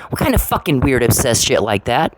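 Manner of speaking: normal talking voice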